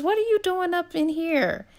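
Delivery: high, startled what-are-you-doing; startled question. A narrator's reading. She wakes to find Clayton in her bedroom, high startled surprise. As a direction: catch him in the room, caught off guard.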